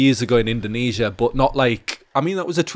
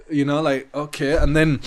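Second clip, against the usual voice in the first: usual speaking voice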